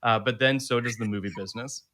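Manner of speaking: steady read-aloud of a synopsis; reading a review aloud. Acted. Host to listeners, reading out the film synopsis.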